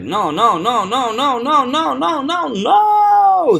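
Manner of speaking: climbing noes, final "no" long, high and loud; rapid "no"s exploding into a stretched final "no"; stress on last NO. Shown as a demonstration. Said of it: "This is the no I wanna stress".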